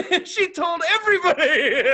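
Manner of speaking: high, loud, through laughter; cracking up. Real, not acted. Telling the friend how she exposed herself, laughing. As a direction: laugh helplessly while landing the punchline.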